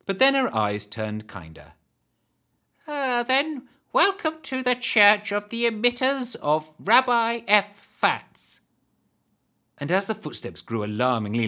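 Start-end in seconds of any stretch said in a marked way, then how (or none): 2.9-8.6 high hag welcome. Acted.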